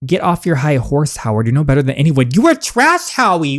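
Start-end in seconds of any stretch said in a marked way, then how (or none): 2.3-3.6 contemptuous jab. Acted. Insult during a heated quarrel.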